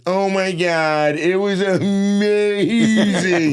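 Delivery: put-on high, slow, drawn-out Valley girl. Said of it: "And she's like".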